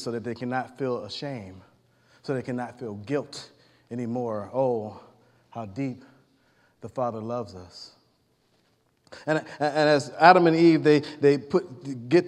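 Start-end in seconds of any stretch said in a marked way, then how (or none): 0.0-7.9 tender awe. Real, not acted. Preacher marvels at God's love.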